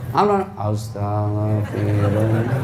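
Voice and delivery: slow, low mock-weeping chant; pretend crying. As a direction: drag the prayer out low as if faking tears. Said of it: "Sambil pura-pura menangis".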